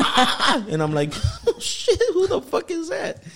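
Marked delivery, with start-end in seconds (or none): 1.5-3.2 amused, high "who is that" alarm; startled reaction. Retold or imitated. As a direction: squeal it through laughter. Retold startled reaction while laughing.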